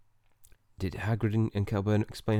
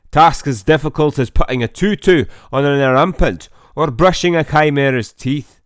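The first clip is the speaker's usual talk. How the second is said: higher and much louder, savouring the dangers; booming teacher; 5 semitones higher, 11 dB louder than usual. Acted. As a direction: roar it like a gleeful beast-keeper. Voicing teacher character in game.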